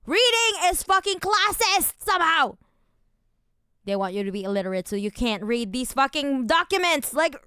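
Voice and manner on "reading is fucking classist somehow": angry rant. Real, not acted. Ranting about classism.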